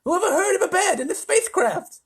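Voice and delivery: loud, very high, lisping; indignant lisping President; outraged disbelief. A narrator's reading. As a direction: scoff at the nonsense.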